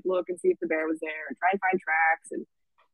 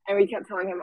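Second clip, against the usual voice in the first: ordinary talk, nothing marked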